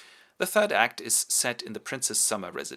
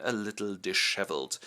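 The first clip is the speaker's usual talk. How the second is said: ordinary delivery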